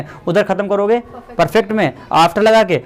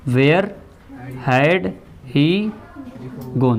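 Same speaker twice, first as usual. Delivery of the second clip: dictating; 4 semitones lower, 4 dB quieter, much slower than usual. Shown as a demonstration. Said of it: "teacher repeating the answer word by word".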